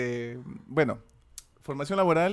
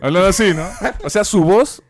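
teacher voice; 3 semitones higher, 9 dB louder than usual. Retold or imitated. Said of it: "a mí me está saliendo como voz de tonto".

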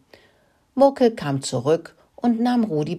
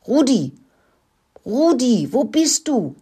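high, raised calling voice; searching call; 8 semitones higher, 4 dB louder than usual. A narrator's reading. Mice searching the henhouse for Rudi, calling out. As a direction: call out for a missing friend. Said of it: "riefen sie".

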